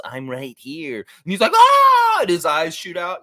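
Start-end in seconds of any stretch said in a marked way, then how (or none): 0.0-1.0 slow, soft deadpan cartoon voice; sleepy slow cartoon dog; Droopy. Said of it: "Yeah, droopy dog". 1.5-2.2 high, loud startled wolf shriek; hammed-up yell of shock; cartoon scream. Imitates cartoon wolf's startled scream.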